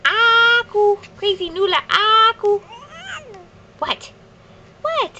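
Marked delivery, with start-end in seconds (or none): 0.1-2.6 put-on high sing-song word for baby; cooing first-word prompt; high coaxing prompt. Shown as a demonstration. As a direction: coax the baby to repeat it, pitched up.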